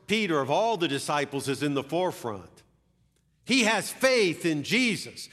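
ordinary talk, nothing marked

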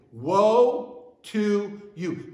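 very slow, drawn-out "Woe to you", "Woe" held long; slow solemn recitation. Shown as a demonstration. Preacher leading congregation in saying it, slow. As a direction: model it slowly for the room to repeat. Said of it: "Say that with me".

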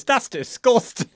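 high, loud, slow outrage; mock furious. Retelling Gregory's reaction to the goal hug, mock furious. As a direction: exclaim in drawn-out mock fury. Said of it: "And Gregory's furious".

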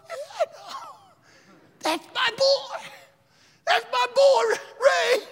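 imitating weeping parents, high and strained; tearful proud yell. Tearful parents boasting of their son, crying shout. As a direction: cry it out loud with overflowing pride. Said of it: "They got tears streaming down their eyes".

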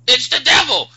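high, loud cry of warning; mock alarmed yell. Imagining that one guy warning the church, mock alarm.

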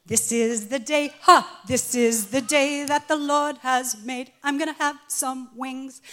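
loud, slow, sing-song delight; husband crowing over his wings; gleeful husband. Retold or imitated. Husband elated at chain restaurant.

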